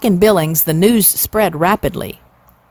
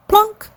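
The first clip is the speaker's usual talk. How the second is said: much higher, plucked banjo plunk; 14 semitones higher than usual. A narrator's reading. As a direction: pluck the word like a string. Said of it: "went the banjo".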